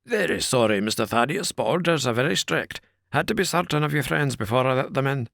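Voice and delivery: a wary doorman sticking to his orders; the porter McMurdo questioning Thaddeus at the door, rough and wary; gruff porter. A narrator's reading. Porter apologises.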